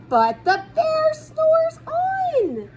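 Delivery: lifted up high and drawn out; playful high drawn-out refrain; high slow refrain. A narrator's reading. Refrain sung out higher.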